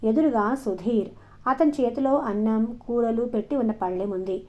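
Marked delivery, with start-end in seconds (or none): none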